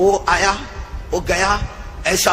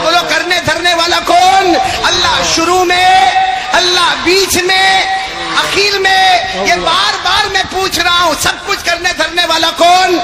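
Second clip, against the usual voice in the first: louder, higher rising sermon call; a preacher drives the gathering toward the creed, loud; preaching at full force; 5 semitones higher, 4 dB louder than usual. Acted. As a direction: build it up for the crowd to answer. Preacher calls for response.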